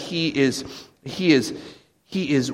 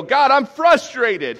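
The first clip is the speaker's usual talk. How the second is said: slow, higher, louder plea; frustrated outcry; 11 semitones higher, 6 dB louder, slower than usual. Retold or imitated. Believer venting to God, frustrated outcry. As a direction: let the frustration break out toward God. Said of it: "when you go".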